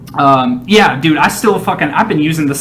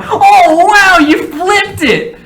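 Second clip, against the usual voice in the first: high, slow gushing audience voice; mocking fake audience; 14 semitones higher, 6 dB louder, much slower than usual. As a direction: squeal it like a fake studio audience. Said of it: "you don't need an audience going".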